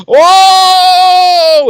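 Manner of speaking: long high whooping whoa; crowd whoa. Retold or imitated. Crowd reacts to the burn.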